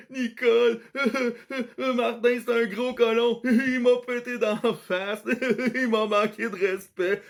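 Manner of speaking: put-on high sobbing kid voice through laughter; hammed-up blubbering bully; mock crying tattletale. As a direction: imitate a bawling kid complaining to the teacher. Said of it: "Il braillait comme une madeleine".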